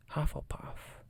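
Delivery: plain reading voice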